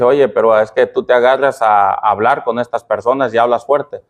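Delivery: scolding wife imitated. Retelling his wife's scolding to the congregation, mock scold. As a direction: mimic a nagging wife, half-hearted. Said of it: "mi esposa me regaña y me dice".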